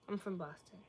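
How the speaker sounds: quiet repeat. Real, not acted. Repeating the answer to where you're from, quietly. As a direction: repeat it softly, as an aside.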